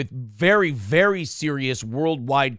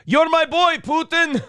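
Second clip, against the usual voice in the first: high, loud bro cheer; mock cheering shout; 11 semitones higher, 4 dB louder than usual. Prigozhin cheering Putin like a frat brother, loud. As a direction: holler it like a proud buddy. Old School quote, shouted.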